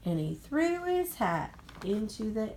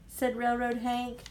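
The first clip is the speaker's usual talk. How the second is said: plain reading voice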